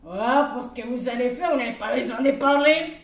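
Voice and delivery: high, loud, pushy groom voice; cheeky groom. Acted. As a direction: egg her on like a rowdy wedding guest. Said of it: "reply of groom".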